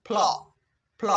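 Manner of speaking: ফ্ল as one joined f-l sound; f running straight into l in ফ্ল; blended "fl" sound. Shown as a demonstration. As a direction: model ফ্ল twice with a pause between. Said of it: "ফ্ল sound উৎপন্ন হবে".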